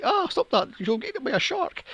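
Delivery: high-pitched mock grandma warning; scolding grandmother. Grandmother warning the little girl off the rope swing, mock scolding.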